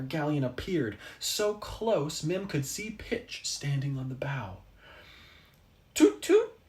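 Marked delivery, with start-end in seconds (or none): 5.9-6.6 put-on very high, loud little baby question; a baby's bright, squeaky toot toot. A narrator's reading. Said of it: "asked the baby".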